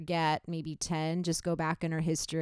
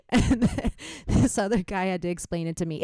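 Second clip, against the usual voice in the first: ordinary talk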